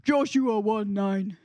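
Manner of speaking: low, loud deep voice. Shown as a demonstration. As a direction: rumble it from the chest. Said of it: "let's try a deep voice".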